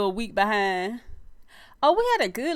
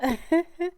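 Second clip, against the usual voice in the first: light giggle; 7 semitones higher than usual. Real, not acted. Giggle greeting viewers on live.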